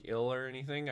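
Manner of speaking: ordinary talk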